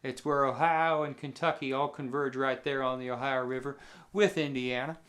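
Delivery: ordinary delivery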